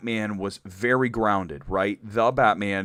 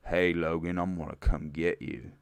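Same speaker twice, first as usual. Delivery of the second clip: put-on Southern accent; 4 semitones lower, 4 dB quieter than usual. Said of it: "That's a pretty good Southern accent".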